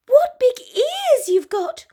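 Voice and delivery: put-on high, slow little girl.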